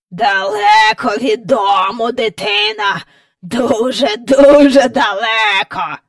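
croaking great-grandfather, taunting; gloating croaky elder; old man's croak. Acted. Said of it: "каркав прадід".

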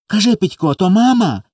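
put-on high little-boy voice, pressing for an answer; pleading child. A narrator's reading. Small boy questions his brother, pleading before tears. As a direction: beg to be told, close to crying. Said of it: "допитувався й Коля й лагодився вже заплакати".

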